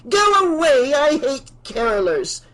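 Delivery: very high, loud angry shout. Acted. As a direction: yell them off the porch. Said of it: "Missus Deagle shouted".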